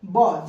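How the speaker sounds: isolated "ब" as the sound of B; short syllable "ब" for the letter B; letter sound demo. Shown as a demonstration. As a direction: say "ब" alone, as a phonics teacher models it. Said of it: "बी का sound क्या आता है".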